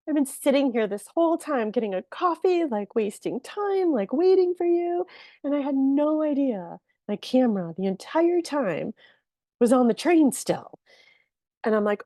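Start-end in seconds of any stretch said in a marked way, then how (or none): 0.1-10.8 high-pitched, louder, worked-up retelling; retold panic, pitched way up; distraught retold outburst. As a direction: wail it out high, reliving the disbelief. Retelling her upset outburst to videographer.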